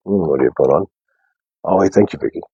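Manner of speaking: ordinary delivery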